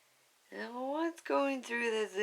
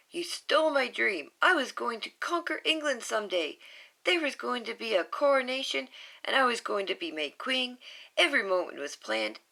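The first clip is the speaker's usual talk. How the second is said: higher, fast, barely contained rant; held-back anger; 6 semitones higher, faster than usual. Acted. Scarlet ranting at Bob for stealing her dream. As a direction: spit it out fast, holding back a yell. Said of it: "she's just holding it back".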